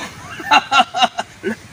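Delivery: high evil cackle; villain laugh. Acted. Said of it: "parang kontrabida yung tawa".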